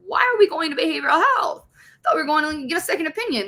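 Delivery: high-pitched retold objection; incredulous protest. Patient questioning the nurse escort, high incredulous protest. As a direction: pitch it right up in disbelief.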